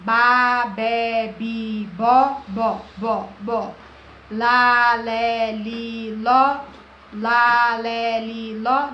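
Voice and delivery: ba, be, bi, bo and lá, lé, li, ló chanted one by one; syllable drill. Shown as a demonstration. Teacher showing how Brazilian kids learn to read. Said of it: "você aprende assim".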